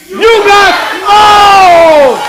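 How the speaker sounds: very loud, high, drawn-out crowd chant; long-held, triumphant taunting yell; full-chested shout. Pretend. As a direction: bellow it slowly with all your might. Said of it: "With all your chest and all your might".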